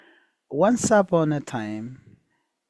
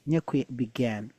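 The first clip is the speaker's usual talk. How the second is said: usual speaking voice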